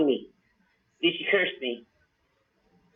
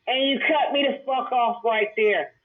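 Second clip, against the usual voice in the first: usual speaking voice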